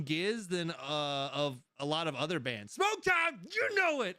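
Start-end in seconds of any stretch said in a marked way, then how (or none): 2.7-4.2 high, loud hype call; excited shout. Real, not acted. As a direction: hype up the crowd. Announcing favorite segment.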